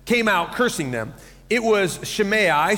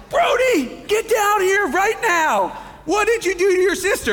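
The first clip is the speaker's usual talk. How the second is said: calling out angrily; 12 semitones higher than usual. Retold or imitated. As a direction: summon the kid with a parent's bellow. Dad calls son downstairs.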